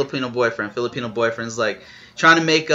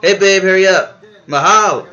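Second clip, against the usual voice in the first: loud, higher-pitched hurry-up call; 6 semitones higher, 8 dB louder than usual. Acted. Filipino boyfriend rushing his girlfriend, calling out.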